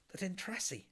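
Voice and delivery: usual speaking voice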